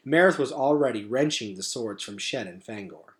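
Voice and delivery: usual speaking voice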